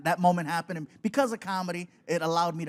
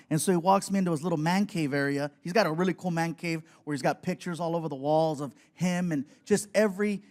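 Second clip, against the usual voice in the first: ordinary delivery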